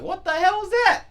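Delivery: shrieked, high and loud disbelief; a high, loud squeal of disbelief, like someone jolted out of their seat; shocked outburst. Retold or imitated. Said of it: "I was like".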